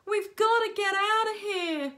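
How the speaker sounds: high, loud panicked shout; boy under his jumper shouting to flee. A narrator's reading. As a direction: yell to get everyone moving. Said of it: "shouted a boy".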